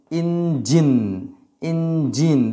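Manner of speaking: ইঞ্জিন said with a clear j; teacher demonstrating ইঞ্জিন; j sound. Shown as a demonstration. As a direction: model ইঞ্জিন slowly. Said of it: "জয়ের উচ্চারণ হবে".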